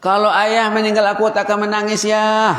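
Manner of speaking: put-on emphatic high child's voice; high emphatic repeat. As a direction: ring it out high for the crowd. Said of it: "repeats the child's line".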